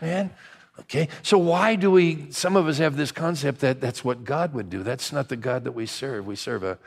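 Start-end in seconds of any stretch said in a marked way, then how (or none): none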